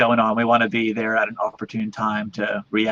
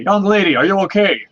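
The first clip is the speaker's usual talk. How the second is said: higher, louder concerned call; cleric calling out; 8 semitones higher, 5 dB louder than usual. Acted. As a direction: call out kindly to the girl. Cleric calls out to girl.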